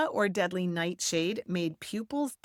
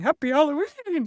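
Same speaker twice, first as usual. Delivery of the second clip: put-on a louder, singsong Happy Halloween to a baby; playful baby talk; 8 semitones higher, 6 dB louder than usual. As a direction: coo it at a giggling baby. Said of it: "was going".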